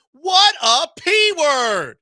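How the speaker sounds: high, loud, slow outburst; outraged exclamation. Real, not acted. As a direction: spit out the insult in disbelief. Host ranting at suspect.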